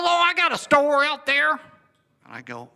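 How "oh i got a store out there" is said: high, loud storekeeper impression; acted-out high, hollering reply; imitating a country storekeeper. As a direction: pipe up loudly as the storekeeper. John's voice acted in story.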